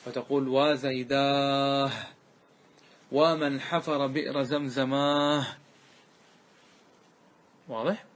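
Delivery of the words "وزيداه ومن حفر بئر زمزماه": "وزيداه" and "زمزماه" slow, long aa closed with h; pausal ha lament. Shown as a demonstration. Teacher demonstrating the silence ha, drawn-out -aah on "وزيداه" and "زمزماه". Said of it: "وهذه الهاء تسمى بهاء السكت".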